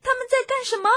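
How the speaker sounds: very high, loud cub shout; little foxes crying out. Acted. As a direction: yell the question out in a little fox's high voice. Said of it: "小狐狸们大叫道". (小狐狸尖声大喊)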